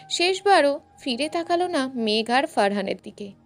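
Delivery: ordinary delivery, nothing marked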